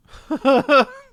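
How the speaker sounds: sudden high squealing laugh; high burst of laughter. Real, not acted. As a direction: crack up at your own joke. Laughs at own silly word.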